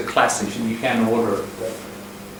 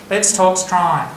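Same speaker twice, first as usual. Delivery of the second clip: broad Australian, Strine through the nose; nasal Aussie Let's Talk Strine; Australian accent; 5 semitones higher, slower than usual. Shown as a demonstration. Teacher asking what the nasal version sounds like. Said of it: "You've got to say it through your nose like that".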